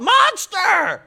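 high, loud shriek of alarm; screamed warning. Retold or imitated. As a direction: shriek it like you just saw a monster. Wife screaming at chipmunk.

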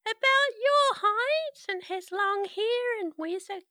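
high, sweet and eerily calm; a ghost child asking too nicely; too calm creepy doll. Acted. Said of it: "GM voices the little girl".

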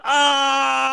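high falling scream. Retold or imitated. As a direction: yell like falling down a shaft. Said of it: "he's like".

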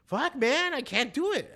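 high, loud retold frustration; exasperated. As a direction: act out throwing in the towel. Acting out his own frustrated reaction.

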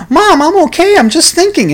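high, pleading denial; pleading protest. Acted. Son insisting to his mom he's fine, high and pleading.

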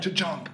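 imitated clunk. Repeating the snake bite sound for a friend, imitated clunk. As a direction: punch out the sound of the shot. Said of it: "It actually made that sound".

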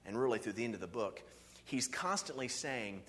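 normal talking voice

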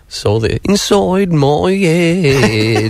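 high, slow, whiny pop-punk drawl; imitating whiny punk singer. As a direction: stretch it out like a sulky punk singer. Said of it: "phrasing like in that song".

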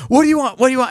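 high, eager repeated question; excited. Retold or imitated. Ring's owner offering the finder anything, excited. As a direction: blurt it out eagerly. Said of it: "this guy's just exci".

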